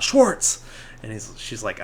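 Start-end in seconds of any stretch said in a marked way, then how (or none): 0.0-0.6 sudden high burst; blurting out. Retold or imitated. Panicked Ralphie names his friend to mom, blurting out. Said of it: "blurts out".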